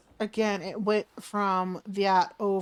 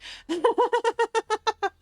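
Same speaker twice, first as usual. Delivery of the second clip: high burst of laughter; 14 semitones higher than usual. Real, not acted. Confused laugh at own mistake.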